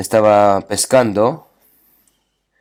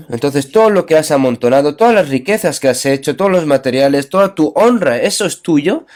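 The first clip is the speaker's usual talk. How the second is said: preaching at full force; 4 semitones higher than usual. Acted. Preacher pressing the congregation about their riches, rising fervent preaching. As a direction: build it up to a pulpit climax.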